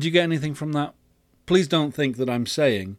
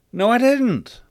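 high, loud denial; sharp retort; 11 semitones higher, 7 dB louder than usual. A narrator's reading. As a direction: fire back a raised-voice denial. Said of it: "retorts God".

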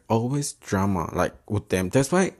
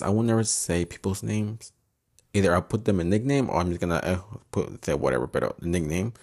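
usual speaking voice

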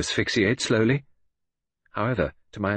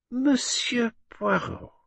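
much higher, slow, drawn-out villainess; much slower than usual. A narrator's reading. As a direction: savour his name like a cat with prey. Villainess addresses Poirot.

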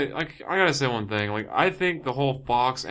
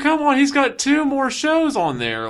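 much higher, louder outraged protest; outraged shout; 13 semitones higher, 8 dB louder than usual. Retold or imitated. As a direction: protest loudly in disbelief. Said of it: "I was like".

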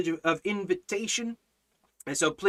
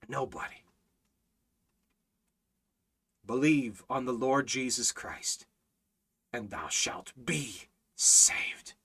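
low, soft scriptural appeal; solemn preaching; 5 semitones lower, 6 dB quieter, slower than usual. Real, not acted. Preacher quoting the promise of salvation, solemn and hushed. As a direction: let it drop and slow toward the end, gravely.

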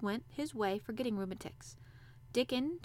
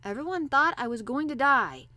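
curt, louder and higher retort; brusque boy's reply; short; 4 semitones higher, 9 dB louder than usual. A narrator's reading. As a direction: answer tersely and emphatically. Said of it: "said Colin shortly".

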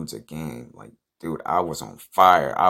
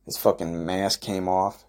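ordinary talk

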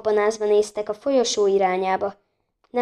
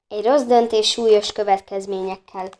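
ordinary delivery, nothing marked

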